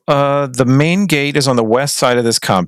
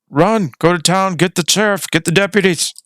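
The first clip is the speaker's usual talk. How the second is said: acted yell; 7 semitones higher than usual. Someone in the compound sends for help, mock yell. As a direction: call out an alarm, voice raised in pitch. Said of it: "you hear someone yell".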